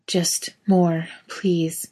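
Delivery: whispered plea; breathy whisper. A narrator's reading.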